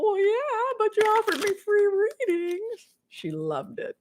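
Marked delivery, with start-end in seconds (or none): 0.0-2.9 high sheepish sister voice; high, hammed-up excuse; imitating sister. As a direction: play the caught-out sister. Sister's retort in banter.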